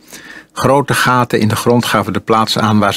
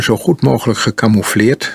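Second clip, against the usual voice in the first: ordinary delivery, nothing marked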